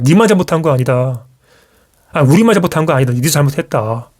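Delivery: ordinary delivery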